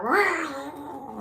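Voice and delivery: imitating a cat in heat with a high yowl; mock cat screech. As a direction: mimic a cat's howl. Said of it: "And she's like".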